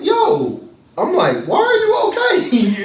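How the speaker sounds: high, shouting, half laughing; high laughing shout of worried surprise; frantic shout. Retold or imitated. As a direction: yell it in shocked disbelief. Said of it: "I was like".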